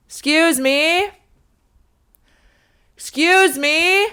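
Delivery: high, slow sing-song excuse me; high drawn-out call for help; drawn-out polite call. Retold or imitated. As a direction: call out politely but urgently, stretching it. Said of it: "for so long and so much louder".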